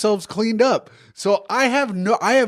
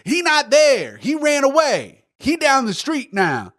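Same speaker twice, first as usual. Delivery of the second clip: imitating neighbor woman; 8 semitones higher than usual. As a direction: mimic her calling across the street. Neighbor woman retold across street.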